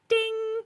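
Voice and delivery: high, held "ding"; sung-out alert sound; imitated text chime. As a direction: mimic a phone notification, pitched high. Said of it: "I just press it and it goes".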